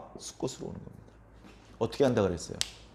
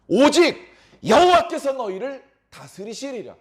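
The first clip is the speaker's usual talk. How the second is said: high, loud scripture proclamation; full-force preaching; 9 semitones higher, 8 dB louder than usual. Real, not acted. Preacher proclaims Gideon's refusal to rule, full-force preaching. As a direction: declare it forcefully to the congregation.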